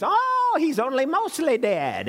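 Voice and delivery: put-on high, whiny old man's voice jumping up on "Oh"; a nasal-free whiny squeak, pitched well up; whiny Miracle Max. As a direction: whine it out like a cranky old healer. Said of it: "he has this whiny voice".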